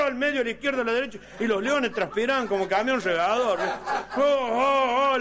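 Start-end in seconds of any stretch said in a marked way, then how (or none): 4.2-5.2 excited oh oh oh. Acted. Crowd cheering.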